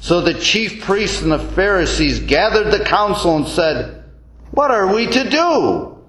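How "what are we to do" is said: ordinary talk, nothing marked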